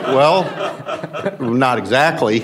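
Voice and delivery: a sheepish laugh while answering. Real, not acted. Freshman answers Packard's lunch invite, laughing.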